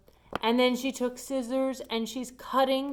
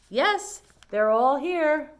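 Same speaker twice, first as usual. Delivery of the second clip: higher, louder, slow and pleased; cheerful; 5 semitones higher, 8 dB louder, much slower than usual. A narrator's reading. Amelia delighted the shirts are all there, pleased and loud. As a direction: sing out that nothing's missing.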